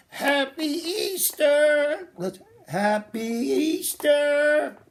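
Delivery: high, slow singsong greeting; broad singsong kids theatre. Acted. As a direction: stretch it out for the kids, sing it high. Puppet show farewell to kids.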